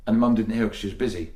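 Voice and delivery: ordinary talk, nothing marked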